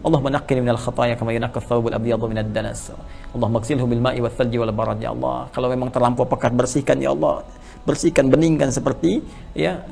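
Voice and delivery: soft, murmured Arabic prayer and plea; quiet supplication. Acted. Preacher murmuring the cleansing supplication softly. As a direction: recite it low and devout.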